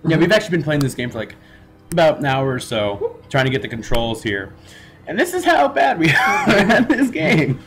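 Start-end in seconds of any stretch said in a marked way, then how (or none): 5.1-7.6 laughing. Real, not acted. Laughing at their bad play.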